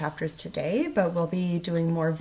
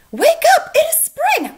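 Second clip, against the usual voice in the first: much higher, loud and bursting with joy; excited shout; 11 dB louder than usual. A narrator's reading. Frog shouting at Toad's door, loud excited joy. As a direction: yell it like spring can't wait. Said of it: "shouted Frog".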